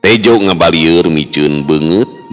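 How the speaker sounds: ordinary delivery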